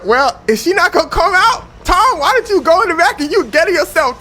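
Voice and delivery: high, loud fed-up host; mock exasperated call. Oprah sends Tom backstage for Katie, loud exasperation. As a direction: raise the voice, urging him to go.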